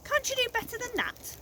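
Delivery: very high, fussy questioning voice; Mad Hatter. A narrator's reading. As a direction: squeak the question like a flustered eccentric. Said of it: "said the Hatter".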